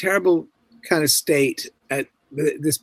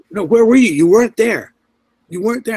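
higher, louder, alarmed demands; frantic questioning; 8 semitones higher, 6 dB louder than usual. Retold or imitated. Young officer who lost sight of him, frantic and loud. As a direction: press him, rattled and urgent.

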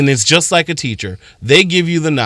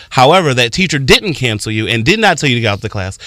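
ordinary talk, nothing marked

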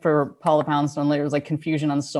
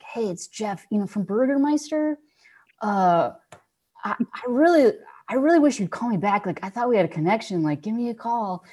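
imitating saddest voicemail; 5 semitones higher than usual. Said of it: "the saddest one".

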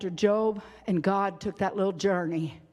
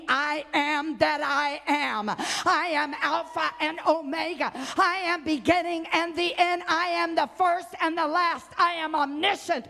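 preaching God's voice; 8 semitones higher than usual. Acted. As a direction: proclaim each I am, higher.

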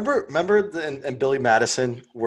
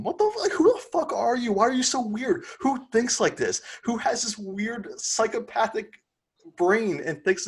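outraged disbelief; 9 semitones higher than usual. Real, not acted. Rant continues, voice jumps high.

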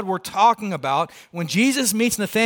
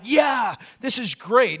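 mock excitement; 5 semitones higher, slower than usual. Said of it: "It's not excitement".

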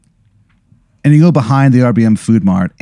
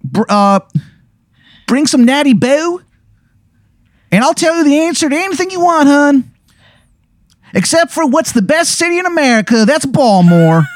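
put-on much higher, quick Baltimore accent; bad Maryland accent. Informant sets a meeting with the player, Maryland accent. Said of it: "the worst Maryland accent".

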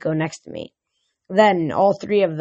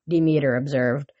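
plain reading voice

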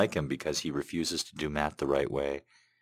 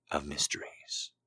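whispered close; 5 dB quieter than usual. Acted. Host ending the Poe poem in a hush, whispering. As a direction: breathe the last words as a whisper. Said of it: "whispered ending of the poem".

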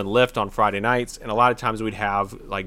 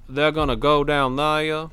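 put-on old Southern accent. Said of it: "the old antebellum South".

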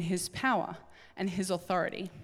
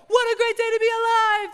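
much higher and louder, beaming morning cheer; overly cheery; 12 semitones higher, 7 dB louder than usual. Pretend. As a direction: greet the kids with over-the-top joy. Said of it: "I'm like".

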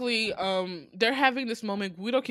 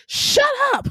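high, loud yell of fed-up irritation; exasperated mock shout; 8 dB louder than usual. Viewer yelling at the annoying Guido, exasperated shout. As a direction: shout it high, as if silencing a pest. Retelling an exasperated reaction to the film.

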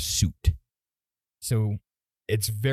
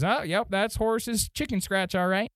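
higher-pitched gnome official voice; second gnome; 7 semitones higher than usual. Acted. Gnome official checking visitors' letters, higher voice. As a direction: pitch up and take charge of the inspection. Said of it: "and says".

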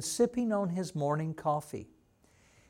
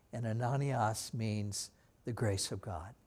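ordinary talk, nothing marked

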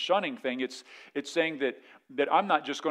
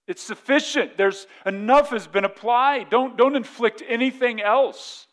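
raised, higher, emphatic appeal; urgent plea; 7 semitones higher, 6 dB louder than usual. Acted. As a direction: plead loudly with the church to stop punishing. Voicing Paul urging the church.

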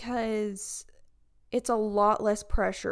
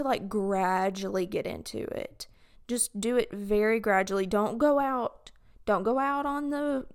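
normal talking voice

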